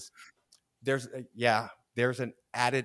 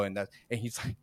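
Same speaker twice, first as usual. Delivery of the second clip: ordinary talk, nothing marked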